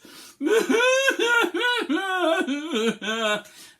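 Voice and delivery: laughing wail; mock sob with laughter. Shown as a demonstration. Teacher cracking up mid sad-noise demo, laughing wail. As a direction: cry and crack up. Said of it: "sad noise".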